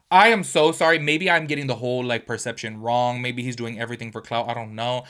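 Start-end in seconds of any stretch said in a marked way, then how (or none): none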